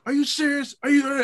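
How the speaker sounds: high, delighted "are you serious"; excited disbelief. Retold or imitated. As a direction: replay the thrill of the surprise message. Thrilled by a DM from Ronnie.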